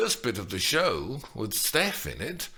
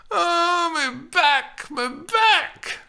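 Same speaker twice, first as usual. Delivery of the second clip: high, loud hammed-up wail; wailing about back; 7 dB louder, much slower than usual. A narrator's reading. Old nurse wails about her back, hammed up. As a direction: overplay the pain.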